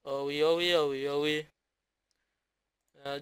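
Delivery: ordinary delivery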